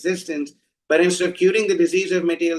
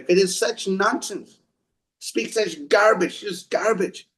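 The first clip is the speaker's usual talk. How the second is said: usual speaking voice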